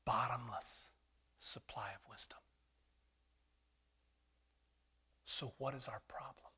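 breathy hushed whisper for effect; dramatic whisper. Acted. Preacher to the congregation, whispered secret. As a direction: drop to a whisper to draw them in.

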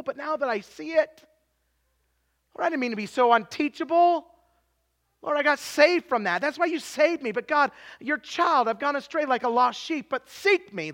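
high, earnest pleading prayer; acted confession to God. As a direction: plead with the Lord as a straying child. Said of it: "We come back to the Lord and say".

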